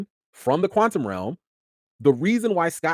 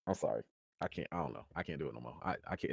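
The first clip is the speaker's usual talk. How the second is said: much softer, lower, fading to a breathy murmur; defeated murmur; 10 semitones lower, 17 dB quieter, faster than usual. Real, not acted. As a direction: sound like you've given up. Giving up, overwhelmed.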